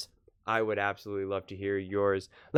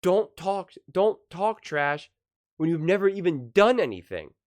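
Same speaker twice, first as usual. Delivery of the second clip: higher-pitched trash talk in imitation; acted-out old-school put-down; Draymond Green; 7 semitones higher, 4 dB louder than usual. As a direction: push it out, punching each phrase. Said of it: "Draymond's old school where it's like".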